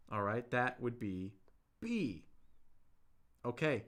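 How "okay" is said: ordinary delivery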